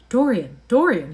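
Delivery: loud, pleading call of his name; cried out. A narrator's reading. Painter begging Dorian, crying out loud. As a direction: cry his name to stop him. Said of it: "he cried".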